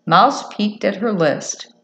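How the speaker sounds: usual speaking voice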